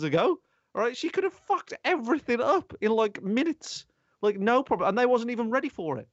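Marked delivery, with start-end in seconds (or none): none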